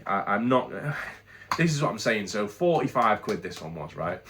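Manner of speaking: ordinary delivery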